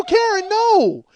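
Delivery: high, loud, drawn-out "no"; alarmed yell. Retold or imitated. Husband stopping wife as drawers fall, alarmed yell. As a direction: cry out to stop a disaster.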